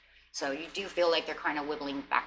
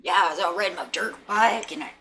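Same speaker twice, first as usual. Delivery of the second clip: louder, pumped-up buddy bragging; bro talk; 3 semitones higher, 5 dB louder than usual. Retold or imitated. Partner bragging about a dirt bike jump like a buddy. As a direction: brag like one of the boys. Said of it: "he's talking to you like he's one of the buddies".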